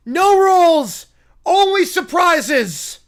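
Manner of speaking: high, loud and theatrical; shouted proclamation. Acted. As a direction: proclaim it at full voice. Acted character announces game rules.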